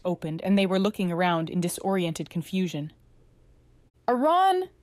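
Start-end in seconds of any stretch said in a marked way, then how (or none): 4.1-4.7 high-pitched, weak croaked call; hoarse croak. A narrator's reading. Waking patient calls for Aran, hoarse croak. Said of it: "they croaked, their voice hoarse".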